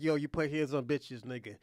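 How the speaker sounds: ordinary talk, nothing marked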